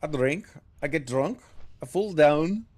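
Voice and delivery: imitating the dwarf in a light Scottish accent. Streamer copying the game dwarf, light Scottish accent. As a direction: parrot the dwarf with a brogue. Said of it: "I could work on my Scottish accent".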